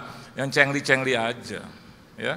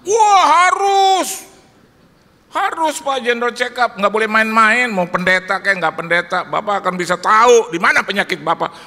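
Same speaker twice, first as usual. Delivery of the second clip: insistent exclaim; 5 semitones higher, 9 dB louder than usual. Retold or imitated. Friend insists pastor must get a checkup, loud and alarmed. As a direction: urge him hard, alarmed.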